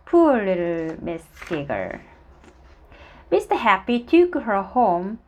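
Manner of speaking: ordinary delivery, nothing marked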